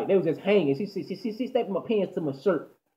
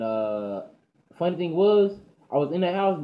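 usual speaking voice

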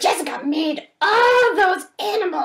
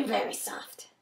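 barely audible whisper; 7 semitones lower, 11 dB quieter than usual. Acted. Teller comments on the soft knock, whispering. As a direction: breathe the words out softly. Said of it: "That was very".

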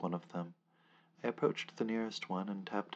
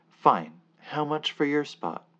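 loud exasperated bargain; 6 semitones higher, 11 dB louder, slower than usual. Acted. Narrator gives in loudly.

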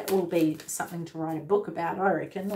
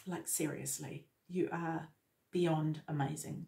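emotional; 3 semitones lower, 7 dB quieter than usual. Real, not acted. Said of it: "you're making me emotional".

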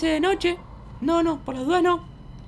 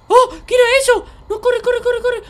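high, loud startled panic; play-acted panic; 6 semitones higher, 8 dB louder than usual. Child spots a creature in the dark forest, panicking. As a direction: panic and urge yourself to run. Flees a strange thing.

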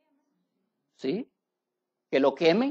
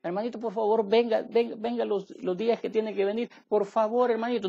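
higher, softer mock begging; mock pleading; 4 semitones higher, 4 dB quieter than usual. Pastor imitating a believer begging God softly. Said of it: "Porque nosotros no entendemos".

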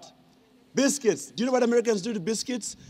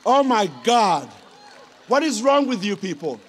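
exasperated; 6 dB louder than usual. Real, not acted. Exasperated at the soap.

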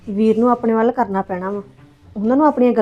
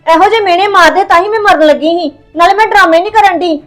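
higher and louder, wounded accusation; higher, louder aggrieved outcry; 5 semitones higher, 4 dB louder than usual. Acted. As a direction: demand why they stopped her. Staged suicide, complaining to brother.

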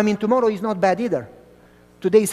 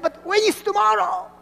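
pleading; 12 semitones higher than usual. Retold or imitated. Boy pleading with vendor.

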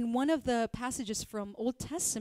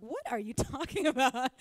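disbelieving servant; 3 semitones higher, 6 dB louder than usual. Acted. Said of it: "And the servant's like".

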